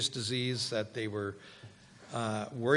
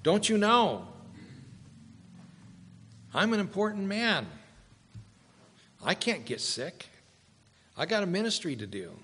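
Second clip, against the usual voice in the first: high, huffy self-important protest; indignant; 5 semitones higher than usual. Pretend. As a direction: play a haughty man offended at being touched. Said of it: "get indignant and say".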